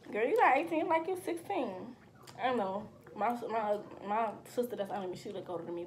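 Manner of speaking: ordinary talk, nothing marked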